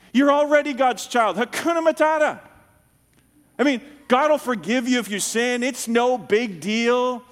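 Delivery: mock carefree. Mocking the permissive ditch.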